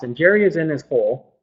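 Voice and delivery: usual speaking voice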